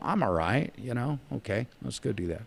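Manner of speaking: soft, offhand retelling of agreeing; casual agreement. Retold or imitated. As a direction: toss it off quietly, like agreeing to a favor. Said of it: "And I'm like".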